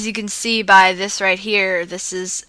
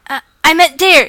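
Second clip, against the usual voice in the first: flustered blurt; 8 semitones higher, 10 dB louder than usual. Acted. Embarrassed character dodges the question.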